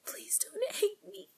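pleading on the edge of crying; tearful pleading. Acted. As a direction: voice breaking into a high sob. Begging priest not to hate her.

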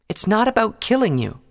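earnest unicorn, a little flustered; Twilight's voice. A narrator's reading.

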